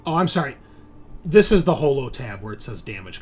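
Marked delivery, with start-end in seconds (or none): none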